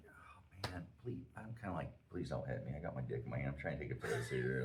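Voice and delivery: retold begging under the breath; meek pleading. Urinating man silently begs stranger not to hit him, meek.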